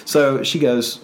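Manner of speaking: ordinary talk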